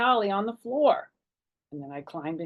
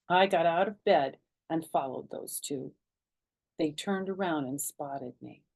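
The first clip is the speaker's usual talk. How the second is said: usual speaking voice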